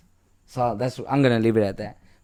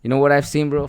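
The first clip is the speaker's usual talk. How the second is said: ordinary talk, nothing marked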